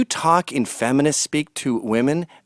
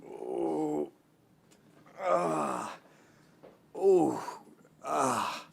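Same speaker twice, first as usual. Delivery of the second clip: mock groans; 12 dB quieter, much slower than usual. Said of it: "trouble sitting down".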